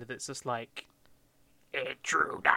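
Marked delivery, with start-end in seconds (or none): 1.7-2.5 loud, harsh mock Dalek alarm; stretched-out monster-robot shout; Dalek shouting from behind. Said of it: "a voice from b-, behind".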